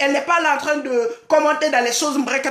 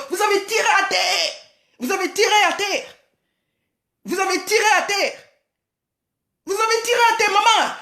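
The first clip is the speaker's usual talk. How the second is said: raised pitch, emphatic taunting cry; defiant taunt; 4 semitones higher than usual. Real, not acted. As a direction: throw it at your rivals, higher each time. Défie ses adversaires en pleine querelle. (provocation lancée avec défi)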